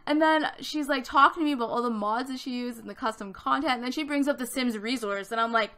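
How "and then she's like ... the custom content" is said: usual speaking voice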